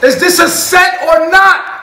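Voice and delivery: loud, slow diva yell at crew; angry shout. Pretend. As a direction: bark at the crew.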